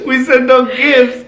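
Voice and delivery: slow, still laughing; laughing repeat. Retold or imitated. Husband laughing, repeating the rule to his wife. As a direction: chuckle the reminder out. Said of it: "I'm like".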